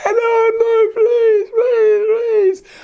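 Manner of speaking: high, loud mock wail; hammed-up crying begging; crying plea. Queen begging the little man, high mock wailing. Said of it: "she was crying again".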